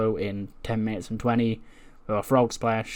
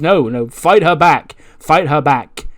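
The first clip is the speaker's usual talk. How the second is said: loud, raised goading, stretched words; urgent egging-on; 3 semitones higher, 8 dB louder, slower than usual. Acted. Mackie urges a cornered friend to fight, loud goading. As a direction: push each command out hard.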